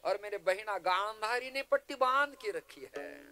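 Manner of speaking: pleading uncle, high and forceful; a scheming uncle's loud, high-pitched appeal; Shakuni. Acted. Shakuni appeals to his brother-in-law for his nephew's future, high impassioned plea. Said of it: "मैं गांधारी नंदन शकुनी कह रहा हूं".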